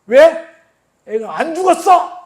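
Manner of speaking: 높고 크게, 느리게 힘준 반박; 무리에게 못 박듯 크게 외치기; 단호한 외침. Retold or imitated. 무리에게 반박하는 예수. (높은 목소리로 크게 잘라 말하기)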